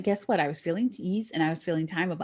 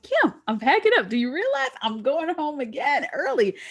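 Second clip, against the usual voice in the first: high, loud thrilled announcement; excited news; 6 semitones higher, 4 dB louder than usual. Retold or imitated. Calling sister Kim, high thrilled announcement. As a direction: tell it bursting with excitement.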